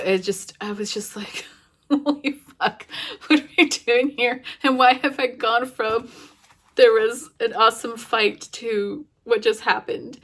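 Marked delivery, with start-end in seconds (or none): 1.9-10.2 higher, louder, bursting with disbelief; a reader stunned by the plot turn, loud and high; overwhelmed disbelief. Retold or imitated. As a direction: blurt it out, floored by the twist. Reliving shock at the book's turn.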